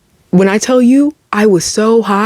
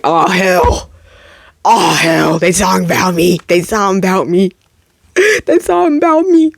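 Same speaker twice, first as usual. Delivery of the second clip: panicked inner voice. Retold or imitated. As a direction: repeat it like a nervous chant. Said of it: "And I was just like".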